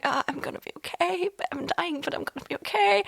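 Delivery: soft, faint reassuring voice; weak reassuring. Retold or imitated. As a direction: reassure her weakly, voice barely there. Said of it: "trying to give her a smile and be reassuring".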